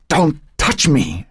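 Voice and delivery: high, slow, strained warning; angry cry. A narrator's reading. Old woman recoiling from grandson's touch, angry cry. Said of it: "she cried".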